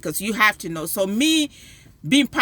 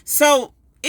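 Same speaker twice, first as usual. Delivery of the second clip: usual speaking voice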